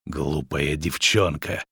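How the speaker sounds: low, slow angry hiss. A narrator's reading. Witcher scolding the runaway girl, hissing angrily. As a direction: hiss it slowly through the teeth. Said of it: "зло зашипел он".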